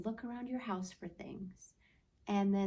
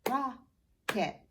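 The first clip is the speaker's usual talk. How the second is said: "Rocket" loud, broken into two beats; clapped syllables; 4 semitones higher, 6 dB louder than usual. Shown as a demonstration. Teacher leading children in clapping, "Rocket" split by syllable. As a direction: say "Rocket" as rock, pause, et, higher. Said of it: "You do that with me".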